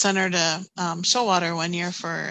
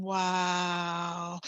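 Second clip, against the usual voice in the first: soft, drawn-out murmur of wonder; low, softened, long exhale of amazement; hushed awe; 3 semitones lower, 6 dB quieter than usual. Retold or imitated. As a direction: let it out slowly, awestruck and hushed. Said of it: "almost like silence for a few minutes".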